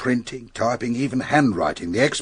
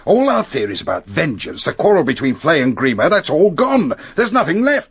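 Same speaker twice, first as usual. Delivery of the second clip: rising higher and louder as the theory collapses; frustrated outburst; 6 semitones higher, 6 dB louder than usual. Acted. Inspector to Fell, exasperated that their case collapsed. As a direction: let it build to an exasperated peak.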